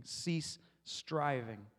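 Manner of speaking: Cease stretched very slowly; slow, weighted Cease; stress on Cease. Shown as a demonstration. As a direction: draw out "Cease", then a deliberate "striving". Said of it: "we're emphasizing one facet".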